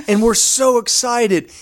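ordinary talk, nothing marked

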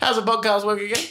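a hint of a tipsy auntie, high; drunk auntie voice. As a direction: play the tipsy auntie, lightly. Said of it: "You do sound like a drunk auntie".

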